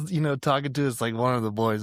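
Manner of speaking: normal talking voice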